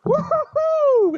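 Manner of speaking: high, loud, drawn-out woo-hoo-hoo; whooping. Real, not acted. Angler whooping after releasing the flathead, loud. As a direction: whoop it out slowly.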